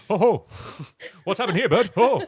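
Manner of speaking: a silly comic playing doctor to a patient, hammed up; hammed-up Robin Williams. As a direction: bounce in like a manic prankster doctor. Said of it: "Robin Williams being".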